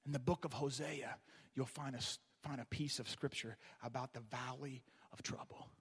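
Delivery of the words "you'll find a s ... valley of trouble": whispered aside. Real, not acted. Soft aside.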